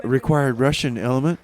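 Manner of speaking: ordinary talk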